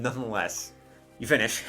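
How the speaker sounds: ordinary delivery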